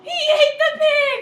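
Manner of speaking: high slow laughing cry; laughing shout. Real, not acted. Laughing shocked exclamation.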